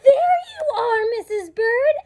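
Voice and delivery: high, happy cry of relief; overjoyed. Acted. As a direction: call out with delight at finding her. Reunion with his wife.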